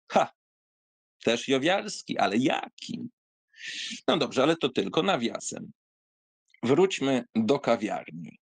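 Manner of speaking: usual speaking voice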